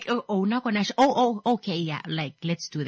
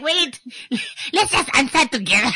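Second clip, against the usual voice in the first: panicky; 8 semitones higher, 5 dB louder than usual. Retold or imitated. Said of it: "You're like".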